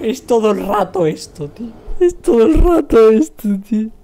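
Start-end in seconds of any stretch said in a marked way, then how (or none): 2.0-3.9 loud, high mock-crying whine; wailing complaint. Streamer complaining it happens all the time, loud high wail. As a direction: wail it as if in tears.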